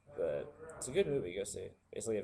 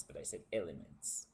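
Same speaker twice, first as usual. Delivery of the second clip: lower-pitched aside in a Russian accent; 5 semitones lower than usual. Shown as a demonstration. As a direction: correct yourself in a Russian accent. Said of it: "I'm still talking in Russian".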